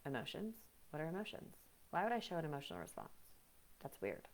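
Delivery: mock the one who thinks feelings are strange; mocking emotionless person. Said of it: "the person that's like".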